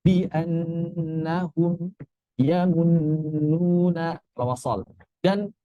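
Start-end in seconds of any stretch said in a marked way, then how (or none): 0.0-4.2 soft, slow reading with the nasal held two counts; slow demo with held nasal. Shown as a demonstration. Teacher demonstrating the held nasal for students. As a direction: hold the nasal sound for two beats. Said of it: "ditahan dua harakat".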